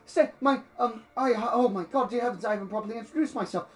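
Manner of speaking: high, pleading, flustered rush; groveling old man. Acted. Old man grovels as the Astartes shuts the door, desperate pleading. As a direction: beg him to stay, tripping over your words. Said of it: "he just grovels out and he's like".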